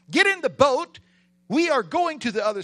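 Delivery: loud, high rallying order; commanding Jesus. Acted. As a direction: call the crew aboard with full authority. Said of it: "So he says to his disciples".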